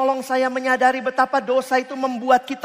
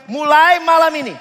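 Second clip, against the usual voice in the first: mock announcement; 5 semitones higher, 6 dB louder than usual. Retelling announcement to landlady.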